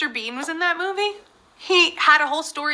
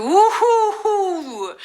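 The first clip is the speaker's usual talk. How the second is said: slow laughing woo-hoo; 7 semitones higher, much slower than usual. Real, not acted. Host cheers after answer.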